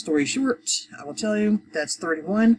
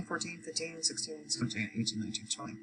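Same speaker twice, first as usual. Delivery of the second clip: counting under breath; 13 dB quieter than usual. Real, not acted. Teacher checking twelve plus eight, counting under breath. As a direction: count along privately, barely audible.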